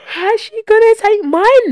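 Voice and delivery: put-on slow, high, loud child voice; childish voice. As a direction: repeat it as the kid did, high and stretched. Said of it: "Greg just said".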